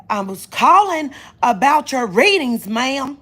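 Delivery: loud, high, emphatic phone voice; hollering caller. Retold or imitated. Caller explaining about readings, hollering it. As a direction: holler each word at the listener. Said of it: "Why are you hollering?".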